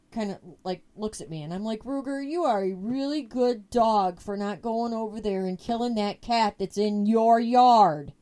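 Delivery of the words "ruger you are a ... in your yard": pointed loud praise. Retold or imitated. To her dog but aimed at the neighbor, loud pointed praise.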